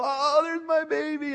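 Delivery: adoring mock-motherly gush; gushing new mother. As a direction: coo over a newborn, pitch way up. New mother gushing over her baby.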